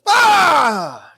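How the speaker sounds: loud sudden jump-scare shout; startling boo. Acted. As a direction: leap out at them yelling. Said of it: "Did I scare ya?".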